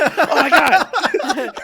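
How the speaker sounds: high, loud and very slow through a laugh; starstruck gasp. Retold or imitated. Fan reacting after a celebrity said hello, giddy and drawn-out. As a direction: gush it out in giddy disbelief, drawn way out. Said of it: "and I'm like".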